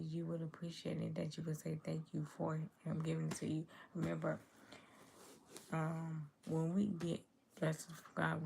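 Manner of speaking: ordinary talk, nothing marked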